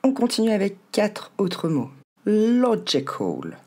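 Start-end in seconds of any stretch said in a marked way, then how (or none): 2.3-3.6 LOGICAL as long, high LO, then "gé-col"; very slow "LO-gical"; first-syllable stress. Shown as a demonstration. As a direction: punch LO in "logical", slowly falling away. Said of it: "L'accent tombe sur la première".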